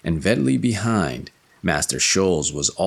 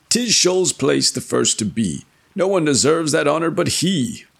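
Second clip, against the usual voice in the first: character voice; 9 semitones higher than usual. Acted. Jackli's quote in tale.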